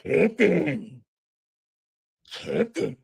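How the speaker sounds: a hint of the eighties killer reveal; lightly voiced film-climax accusation; dramatic movie reveal. Shown as a demonstration. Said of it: "Every time I walk".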